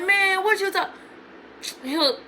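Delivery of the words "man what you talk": high-pitched mock male denial; imitating defensive boyfriend. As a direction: mimic him protesting innocence.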